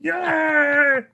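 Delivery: shouted high yeah; loud yay. Acted. Host celebrating the special guests with a high loud shout. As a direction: belt it out with a whoop. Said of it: "pull away from the microphone so it doesn't pop".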